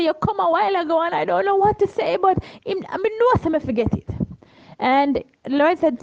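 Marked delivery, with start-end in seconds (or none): 0.0-4.1 overcome man. Retold or imitated. Crying stranger confesses to Cheryl, higher choked voice. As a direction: let the stranger's voice climb as he breaks down. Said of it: "And he said".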